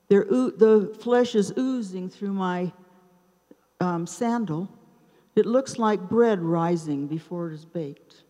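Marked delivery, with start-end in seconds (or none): none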